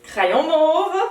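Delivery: high, loud happy cry on "Crayon mauve!"; teacher suddenly spots her purple pencil; excited exclamation. Real, not acted. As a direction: call out the lost purple pencil. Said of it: "J'ai pas mon crayon mauve".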